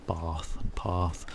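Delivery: "Bath and path" slowly, each a held long; slow vowel demo. Shown as a demonstration. Streamer slowly sounding out "Bath and path" for chat. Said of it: "I say bath, not bath".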